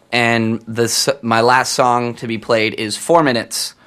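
ordinary delivery

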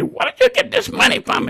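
high-pitched scolding grandma; grandmother's voice. Retold or imitated. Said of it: "My grandmother, Lucinda".

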